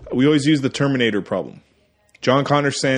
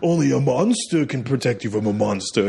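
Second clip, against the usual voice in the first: persuasive villain. Retold or imitated. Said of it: "He convinces this kid".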